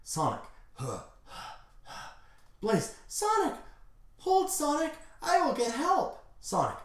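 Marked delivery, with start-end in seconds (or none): 0.7-2.1 soft voiceless panting; acted laboured breaths, very quiet; breathing heavily. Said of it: "Breathing heavily". 3.1-6.2 very high falsetto, urgent; hammed-up distressed heroine; Blaze. Blaze promises help to wounded Sonic.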